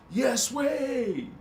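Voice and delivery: high excited surfer-dude retort; Bill and Ted dude. Retold or imitated. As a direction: fire back like a stoked dude. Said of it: "Says the other dude".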